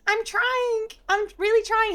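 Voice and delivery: mock plea, pitched right up; imitated pleading. Retold woman pleading she's trying.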